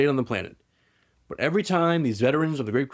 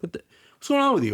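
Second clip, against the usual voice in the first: high, startled outburst, lightly acted; shocked cry; 11 semitones higher than usual. Loken watching his brother transform, alarmed high cry. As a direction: jump up in pitch in alarm.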